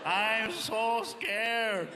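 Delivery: very slow, drawn-out fake fright; mock scared. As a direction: imitate a spaced-out friend feigning fear. Friend [name] mocks the cop.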